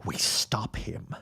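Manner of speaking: slow, breathy hush, voice unvoiced; a grim, secret vow; conspiratorial whisper. Plotting revenge against the Reverend.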